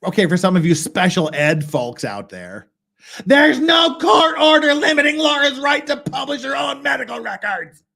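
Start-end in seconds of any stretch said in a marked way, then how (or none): 3.2-7.8 high, loud mocking quote. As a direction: shout it like a mock.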